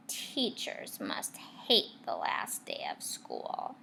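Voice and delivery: slow, low whisper; hushed. A narrator's reading. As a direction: confide it gravely. Narrator reads gloomy thought.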